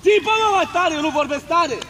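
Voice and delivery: high, scolding coach's yell; insistent yell. Real, not acted. Coach orders player to shout louder.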